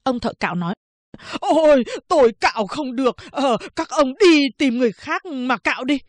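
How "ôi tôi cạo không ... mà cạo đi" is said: high, loud fretful barber voice; whiny refusing barber. Acted. Barber refuses to finish the shave, high and whiny. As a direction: refuse with a whine.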